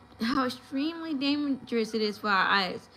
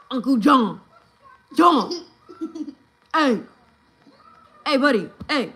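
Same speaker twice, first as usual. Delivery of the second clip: loud, higher calls to a deaf uncle; calling out loudly; 3 semitones higher, 9 dB louder than usual. Retold or imitated. Relatives loudly calling deaf Uncle John from across the couch. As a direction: shout his name to get his attention.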